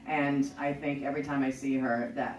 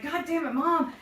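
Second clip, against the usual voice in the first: much higher exasperated cry at mom; mock-frustrated teenage groan, much higher; exasperated outburst; 12 semitones higher, 5 dB louder than usual. Caught by mother, argument.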